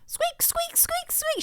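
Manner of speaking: tiny, very high squeaks; soft falsetto squeaking; imitated toy squeak. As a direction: squeak softly like a dog toy.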